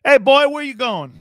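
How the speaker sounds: loud, high challenge from a sheriff; Texas sheriff. Retold or imitated. Texas sheriff stopping a driver, loud imitation. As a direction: call out like a big Texas sheriff. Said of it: "Texas sheriff come up and he starts going like this".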